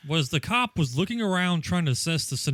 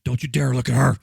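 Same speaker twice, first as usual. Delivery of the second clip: louder, lightly voiced jealous threat; possessive criminal; 2 semitones lower, 6 dB louder than usual. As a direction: warn him off like a jealous captor. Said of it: "the criminal was like".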